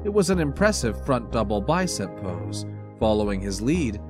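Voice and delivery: plain reading voice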